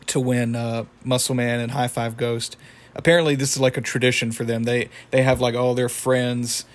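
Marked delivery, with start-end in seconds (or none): none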